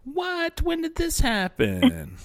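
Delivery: high, squeaky fake surprise; hammed-up astonishment; mock shock. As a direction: play it up like stunned news. Mock shock at baby news.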